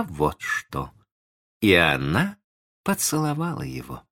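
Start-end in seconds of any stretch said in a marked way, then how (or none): none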